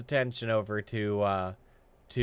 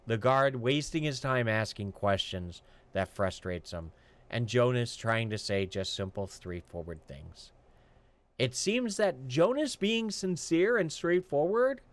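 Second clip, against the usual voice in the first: ordinary talk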